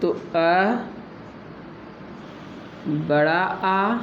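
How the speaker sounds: अ then बड़ा आ stretched slowly, each vowel held; teacher stretching each vowel for students; drawn-out vowel demo. Shown as a demonstration. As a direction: slowly hold अ, then a long आ, to show the sound. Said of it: "कंठ से कौन कौन सा word बोला जाता है".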